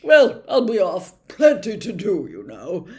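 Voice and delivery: busy man hurrying off; Fudge leaving. A narrator's reading. Minister leaving Harry, loudly busy.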